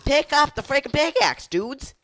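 high, loud scolding; shrieky high-pitched complaint; exasperated yelling. Real, not acted. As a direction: yell at teammates who won't listen. Scolding players over a pickaxe.

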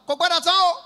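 high, loud call back; imitated loud crowd response; crowd's answering shout. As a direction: shout back like eager customers. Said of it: "People go shout".

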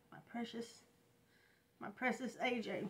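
almost silent, breaking over her son's name; my precious A J, whispered through grief; tender grief. Real, not acted. Mother naming her dead son.

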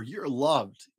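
usual speaking voice

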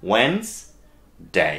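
"ওয়েন্সডে" slowly, split as wens... day; silent D. Shown as a demonstration. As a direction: drop the D and pause before day in "ওয়েন্সডে". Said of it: "ডিটাকে বাদ দিয়ে". ("ওয়েন্সডে" ভেঙে ধীরে, ড বাদ দিয়ে)

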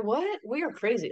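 high-pitched mock disbelief; incredulous. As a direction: blurt it high, as if baffled. Mock disbelief at apologising for crying.

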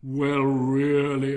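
loud, drawn-out indignation; affronted miller. Acted. Miller answers Hans's timid excuse, loudly affronted. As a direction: stretch it out loudly, greatly affronted.

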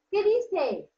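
ordinary talk

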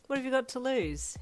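cocky, smiling challenge. A narrator's reading. Hero leaning on her desk talks her into going out, cocky. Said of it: "he asked with a cocky smile".

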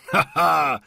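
acted hearty "ha, ha", high and loud; laughing. A narrator's reading. Watchmaker laughs at old Mendel's argument, laughing. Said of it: "roześmiał się zegarmistrz".

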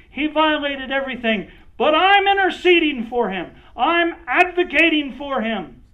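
high, loud pleading to Daddy; pleading cry. Acted. Jesus intercedes with the angry Father, high and loud pleading. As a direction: cry out and beg the angry father to spare him. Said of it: "she cries out and goes".

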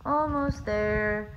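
ordinary delivery

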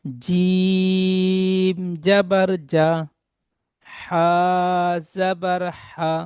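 each letter drawn out slowly and sung; slow teacher's chant of the alphabet; slow spelling drill. Shown as a demonstration. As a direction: chant the letters with their vowel, stretching every name. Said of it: "তাহলে এখানে পড়বেন".

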